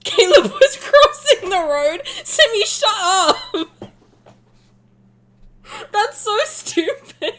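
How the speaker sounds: high, slow, loud words through laughter; laughing fit. Real, not acted. Streamer cracking up at Caleb crossing the road. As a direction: crack up and barely get it out.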